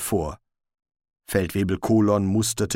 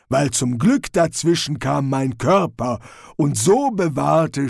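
raised, fairly loud troll report; cheerful troll; 4 semitones higher, 4 dB louder than usual. Acted. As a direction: report dutifully as a simple troll. Troll character voice. (fröhlich meldender Troll)

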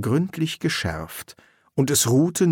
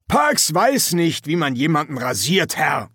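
high, projected sergeant's voice; forceful sergeant; 6 semitones higher than usual. Acted. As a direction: cut in with military authority. Feldwebel fährt dazwischen. (energische Feldwebelstimme)

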